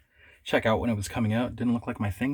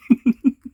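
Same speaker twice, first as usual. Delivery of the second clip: loud high laugh bursting out; burst of laughter; 12 semitones higher, 10 dB louder than usual. Real, not acted. Reader bursting out laughing at the comic, loud laugh. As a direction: crack up loudly. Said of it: "hysterical to me".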